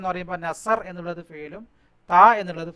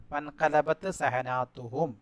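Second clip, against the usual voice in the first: വൻഖലബത്ത് സഹനാത്തുഹും read out slowly and carefully; careful slow model of the sentence; slow recitation; 3 semitones lower, 4 dB quieter, much slower than usual. Shown as a demonstration. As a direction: slowly recite the corrected Arabic line. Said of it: "teacher recites corrected sentence".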